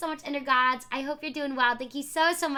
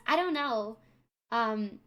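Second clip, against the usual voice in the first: ordinary talk, nothing marked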